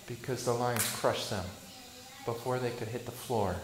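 ordinary talk

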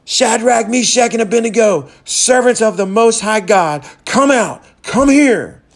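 high, loud call into the furnace; a king calling the three men out of the flames; shouting king. Retold or imitated. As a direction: summon them out of the fire at full voice. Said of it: "and he shouted".

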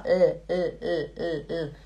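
repeated short L, tongue on the palate; isolated L sounds. Shown as a demonstration. Teacher showing tongue placement, repeated L. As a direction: pulse a bare "L" sound, evenly. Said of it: "Você faz assim, ó".

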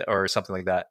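ordinary delivery

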